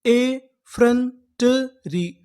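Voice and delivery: very slow, ए and फ्रंटरी split apart; ए फ्रंटरी drawn out in two parts; slow syllable-by-syllable. Shown as a demonstration. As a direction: build the word slowly for students. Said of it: "तो पूरी meaning क्या बनी".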